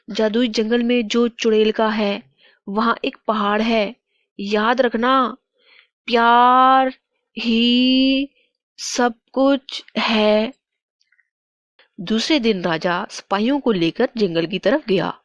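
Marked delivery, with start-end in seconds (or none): 0.1-10.5 solemn message, stretching out the last words; parting spirit. Acted. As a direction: give a last clue, slowing dramatically at the end. (विदा होती रूह का गंभीर संदेश)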